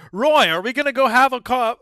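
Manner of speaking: loud, high-pitched, hammed-up English accent; over-the-top bad English accent impression; terrible English accent. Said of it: "that was the worst English accent".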